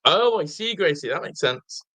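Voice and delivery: ordinary delivery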